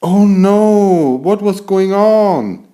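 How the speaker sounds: high, loud panicked outcry; alarmed cry. Acted. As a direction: cry out as the capsule falls. Sudden crash on the moon.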